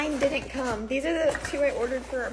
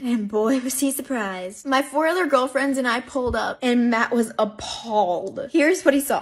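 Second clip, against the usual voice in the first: louder, punchy story narration to camera; storytelling narration; 7 dB louder than usual. Acted. Telling viewers their Halloween story, punchy narration. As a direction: build the Halloween tale like a reveal.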